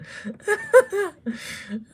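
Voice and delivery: shrill helpless laughter; high squealing laugh. Real, not acted. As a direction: crack up high and hard. Big laugh at joke.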